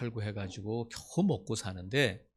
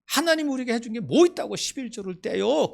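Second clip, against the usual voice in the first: put-on high, loud furious outburst of a boy; angry child; 12 semitones higher, 8 dB louder than usual. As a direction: shout back at mother in anger. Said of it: "막 화를 냈다는 거야".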